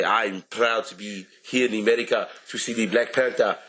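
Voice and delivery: high put-on African accent. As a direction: proudly announce in an African accent. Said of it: "I will do my African voice".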